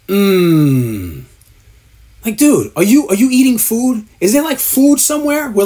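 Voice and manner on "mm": very slow low hum; mock drawn-out hum. Mocking Joe Rogan humming at a guest, slow drawn-out hum. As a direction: savour it like a bite of food. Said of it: "he's like".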